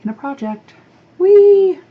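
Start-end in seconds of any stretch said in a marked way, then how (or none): 1.2-1.8 high, loud gleeful whee; excited squeal. Real, not acted. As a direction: let out a happy squeal. Playful cheer after announcing project.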